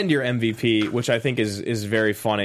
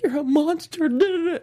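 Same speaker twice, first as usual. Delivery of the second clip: mock high voice; 14 semitones higher than usual. Mocking Natasha's line.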